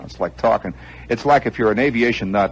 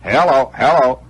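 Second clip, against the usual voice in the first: loud, raised test calls; mic test call; 6 semitones higher, 8 dB louder than usual. Retold or imitated. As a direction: call out into a dead microphone. Acting out a microphone test.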